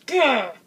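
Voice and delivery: short, loud, high "gah" of exasperation; high-pitched frustrated outburst; frustrated yell. Retold or imitated. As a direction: burst out in retold fury. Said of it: "I was so mad".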